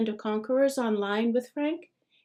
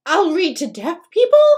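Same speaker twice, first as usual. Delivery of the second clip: higher, much louder flailing suggestion; wild desperate offer; 7 semitones higher, 11 dB louder than usual. A narrator's reading. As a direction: son grasping for anything to offer. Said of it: "he's casting about wildly".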